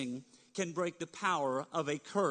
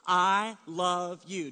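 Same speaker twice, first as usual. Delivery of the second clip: slow, louder heartfelt I love you; earnest I love you; 7 dB louder, much slower than usual. Shown as a demonstration. Pastor leading congregation in saying it, slow and heartfelt. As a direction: say it out, slow and sincere. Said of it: "Let's say that together".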